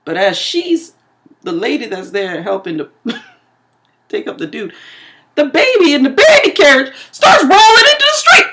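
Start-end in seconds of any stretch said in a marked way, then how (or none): 5.4-8.5 loud, high storytelling outburst; high-pitched yell of alarm; alarmed climax. Real, not acted. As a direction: shout it in disbelief at the disaster. Climax of the runaway carriage story.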